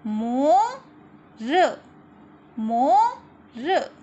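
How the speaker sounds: मोर loud, the second slowly drawn out; read-along word. Shown as a demonstration. Teacher reading words aloud for children to repeat, slow and loud. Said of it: "मेरे साथ आप लोगों को भी पढ़ना है".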